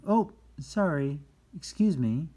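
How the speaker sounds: quick high, loud apology; startled apology. Pretend. Someone who just bumped into another, startled apology. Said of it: "bump into someone".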